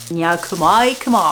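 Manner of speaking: slogan de pub Maille, chantonné; récite le slogan comme la pub. Retold or imitated. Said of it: "c'est le slogan". (imitation légère du slogan Maille)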